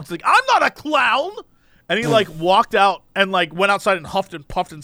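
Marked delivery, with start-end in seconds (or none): 0.2-1.3 high, slow mock indignation; retold mad protest. Stranger storming out of the coffee shop, mock indignant and drawn out. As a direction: drag it out as an imitated offended man. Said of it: "was so mad".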